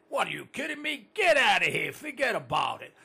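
loud New York brush-off; New York accent. Retold or imitated. Host showing how to talk in New York, loud New York accent. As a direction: shout "Get out of here" like a New Yorker. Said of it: "do the three things".

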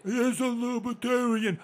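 slow, high singsong mimicry; stretched, mocking echo; mocked accuser. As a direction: parrot it back in a drawn-out silly voice. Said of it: "What do you mean?".

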